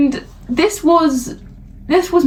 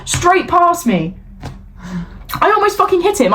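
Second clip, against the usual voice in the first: ordinary talk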